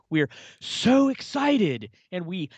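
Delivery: sarcastic slow Apple-keynote voice; mocking Apple exec. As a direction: drag out the fake enthusiasm, mocking. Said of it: "everyone at Apple say in every single".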